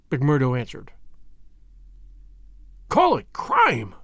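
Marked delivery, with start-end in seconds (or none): 2.9-3.9 slow, high outburst of passion; vibrating with passion. A narrator's reading. Older brother appalled at the word's doubt, passionate. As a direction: throw the words back in impassioned outrage. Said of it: "his voice vibrating with passion".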